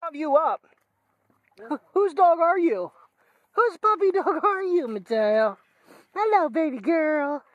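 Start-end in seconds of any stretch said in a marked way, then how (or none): 1.6-5.6 high, slow, cooing puppy talk; sing-song pet voice, drawn out. Real, not acted. 6.1-7.4 high, slow, cooing puppy talk; sing-song pet voice, drawn out. Real, not acted. Greeting the dog.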